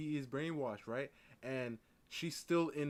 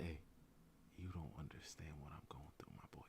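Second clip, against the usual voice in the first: whispered, much softer, confiding; hushed aside; 9 semitones lower, 15 dB quieter than usual. Pretend. Man confiding in a bystander about his girlfriend, whispered. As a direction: whisper it like a secret to the stranger. Imagined calm talk with bystander.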